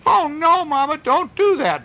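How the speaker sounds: put-on high, loud begging child; storyteller wailing as a boy; pleading child. A narrator's reading. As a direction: beg mother to stop like a small boy. Boy begs mother to stop.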